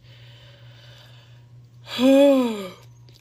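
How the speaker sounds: long, loud exhale; exasperated heavy sigh. Real, not acted. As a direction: heave a big, drawn-out sigh. Dreads going out to him.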